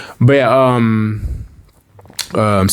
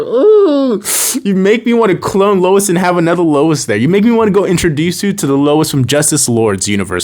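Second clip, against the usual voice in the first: mock pleading; 7 semitones higher than usual. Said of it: "I was like".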